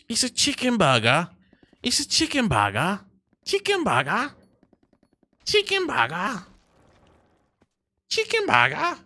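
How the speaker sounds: high, squeaky mock-British voice; mocking British accent. Streamer mocking what you guys call it, squeaky British accent. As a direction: mimic British viewers in a silly high pitch. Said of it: "you guys call it".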